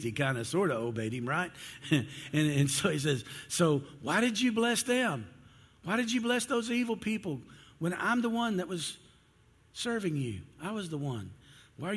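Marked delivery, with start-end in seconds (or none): 3.5-11.9 high, aggrieved protest to God; indignant complaint. Acted. Jonah protests to God about blessing others, indignant and high. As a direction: plead the injustice, pitched well up.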